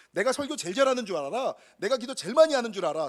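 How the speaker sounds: proud boast. Retold or imitated. Son's proud belief voiced.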